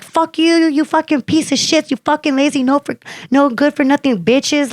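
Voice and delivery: indignant trash-talking rant; retold cussing-out. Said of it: "I'm talking shit to, to all the guys".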